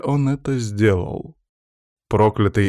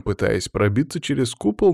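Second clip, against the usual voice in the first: ordinary delivery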